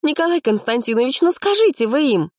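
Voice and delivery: high, pleading complaint; indignant appeal. A narrator's reading. Activist asks teacher to tell them, exasperated. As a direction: complain upward to authority, pitched high.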